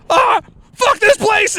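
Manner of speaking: very high, drawn-out panicked cry; hammed-up squealing panic. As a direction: imitate a friend bolting in terror. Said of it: "Luke just ran out in a panic".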